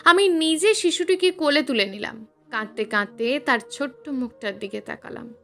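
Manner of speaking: plain reading voice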